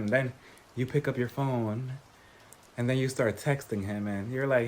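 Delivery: low, soft, lingering storytelling; mock late-night intimacy. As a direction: draw them into a lonely midnight confession, hushed and teasing. Sultry late-night scenario, then breaks off laughing.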